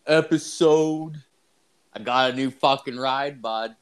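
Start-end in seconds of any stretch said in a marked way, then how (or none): 0.1-1.2 slow, drawn-out show-name announcement; podcast intro announcer. Acted. Host opening the episode, slow announcer voice. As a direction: stretch the show title out like an announcer.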